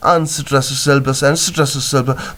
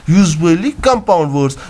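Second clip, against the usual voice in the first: ordinary talk, nothing marked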